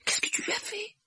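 hushed, whispery question; shocked murmur, barely voiced. A narrator's reading. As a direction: ask it under your breath. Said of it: "murmura Harry".

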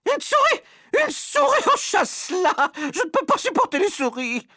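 piercing, extremely high shriek; panicked screaming. Acted. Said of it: "Elle bondit hors de son fauteuil en hurlant".

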